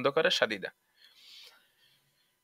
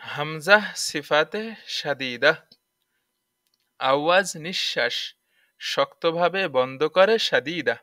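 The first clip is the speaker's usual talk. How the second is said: slow, even sing-song recital of the rule; slow drilled rule; 2 semitones higher than usual. Shown as a demonstration. Teacher drilling the class in a slow chant. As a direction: chant it slowly so students can repeat. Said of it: "teacher drills the rule rhyme".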